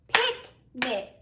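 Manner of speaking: Picnic split into two clapped beats, pic and nic; clapped syllables. Shown as a demonstration. Teacher claps Picnic with the class, syllable by syllable. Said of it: "Clap that word with me".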